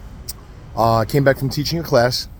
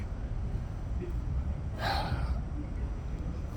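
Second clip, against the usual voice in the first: long soft breath out before going on; sigh, gathering yourself; heavy sigh; 15 dB quieter than usual. Real, not acted. Pause before telling how he helped.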